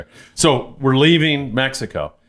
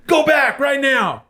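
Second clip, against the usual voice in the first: slow, much higher imitation of a cop's command; retold cop order; slower than usual. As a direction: play the cop ordering him back, word by word. Tijuana cop repeats the order.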